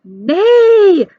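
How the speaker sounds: long loud high yell. A narrator's reading. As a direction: bellow nay at full voice. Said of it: "they all yelled".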